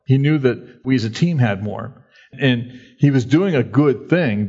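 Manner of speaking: ordinary talk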